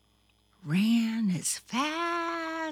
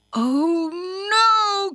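high, loud cry of dismay; alarmed cry; 11 semitones higher, 7 dB louder than usual. A narrator's reading. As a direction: swell from a held oh into alarm. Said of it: "cried Thumper".

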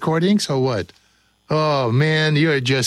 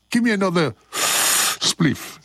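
higher voice with a spliff; smoker's put-on voice, raised pitch; mock spliff smoker; 4 semitones higher than usual. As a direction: play the stoned reggae man. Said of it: "I sound like I have a spliff".

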